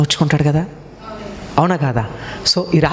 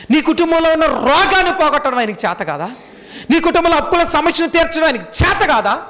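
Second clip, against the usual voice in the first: preaching at full force; 9 semitones higher than usual. Acted. Pastor presses doubters with rising questions, preaching at full force. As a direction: build the list to a shouted peak.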